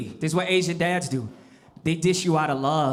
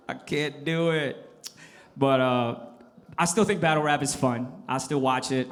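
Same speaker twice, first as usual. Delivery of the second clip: ordinary talk, nothing marked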